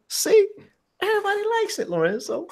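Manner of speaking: high-pitched, amused teasing; gleeful teasing. Real, not acted. Host teasing Lorenzo that everybody likes it, gleefully. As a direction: tease him in a high voice, breaking into a laugh at the end.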